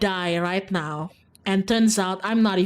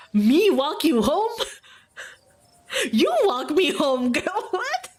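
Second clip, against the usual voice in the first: high, slow, laughing through the words; cracking up at an absurd idea; laughing disbelief; 10 semitones higher than usual. Real, not acted. Streamer ridiculing walking Stella home, laughing hard.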